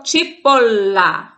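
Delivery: slow "cipolla" with a long held L; double consonant shown. Shown as a demonstration. Teacher showing students a doppia, drawn-out double L in "cipolla". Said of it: "é, uma doppia".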